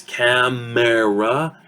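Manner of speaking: CAMERA slowly as cam-air-ah; unreduced. Shown as a demonstration. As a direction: sound out all three vowels of CAMERA. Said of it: "will actually sound".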